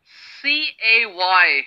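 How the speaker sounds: slow letter-by-letter spelling; slow spelled letters. Shown as a demonstration. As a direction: spell V-A-C-A-Y slowly, each letter apart. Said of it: "हमारा first word है".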